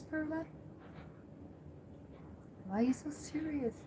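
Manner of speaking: high, soft cooing pet voice; baby talk to cat. Acted. Owner coos at her serious cat, high soft baby talk. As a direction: coo softly at the cat like a baby.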